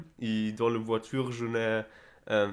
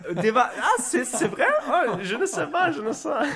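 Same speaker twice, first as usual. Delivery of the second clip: surprised laugh, high and loud; 13 semitones higher, 5 dB louder, faster than usual. Real, not acted. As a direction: laugh it off in disbelief. Surprised at accent remark, laughing.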